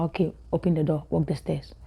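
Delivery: soft, fast, inner muttering; quiet inner voice. Retold or imitated. Client following the hypnotist in her mind, soft and fast. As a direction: rush through it in your head. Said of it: "I'm like".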